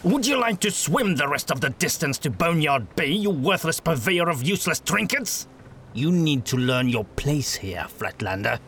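a hint of a stern witch hunter threatening. A narrator's reading. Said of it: "grabbed him by his shirt".

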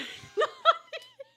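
very high, loud burst of laughter; high-pitched cackling laugh; shrieking laugh. Real, not acted. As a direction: squeal with laughter. Cracks up at the pun.

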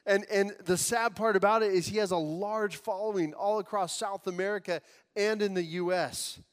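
ordinary talk, nothing marked

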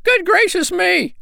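very high, shocked little bird squeal; aghast tiny sandpiper; crying out. A narrator's reading. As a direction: squeak in horror, loud and drawn out. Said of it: "cried both the little sandpipers".

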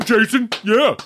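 high, loud mock fan; root for the action hero like a superfan; hammed-up moviegoer cheer; imitated cheering.

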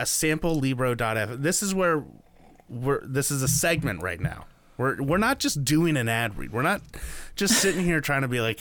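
ordinary talk

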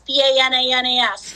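B A N A N A S, each letter separate; spelled out. Shown as a demonstration. Hiker spelling out the word for emphasis, letter by letter. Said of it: "it was going like bananas".

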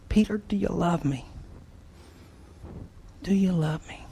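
soft, hushed, pleading question; Jesus softly asking Peter a third time; tender plea. Acted. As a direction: ask gently, almost in a whisper.

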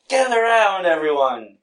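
loud, high carnival barker call; loud street performer hustle. Retold or imitated. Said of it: "Don't you ever do that again".

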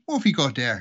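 lightly voiced Irishman in a joke; a hint of a joke-Irish accent. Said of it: "the Irish was the thick guy".

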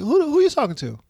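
stern parent. Retold or imitated. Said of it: "I say".

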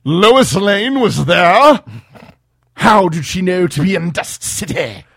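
high, loud villain voice; hammy Lex, indignant; new Lex voice. Acted. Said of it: "I'm gonna try a different voice for Lex".

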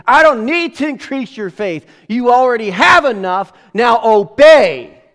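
high, loud commanding voice of Jesus; stern command. Acted. Jesus ordering his doubting disciples, stern loud command.

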